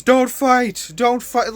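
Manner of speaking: high, loud mock shout of don't fight; retold shout. Tkachuk yelling from the bench at his teammate, high mock shout. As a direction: ham up a panicked yell from the bench. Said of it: "could shout from the fucking bench".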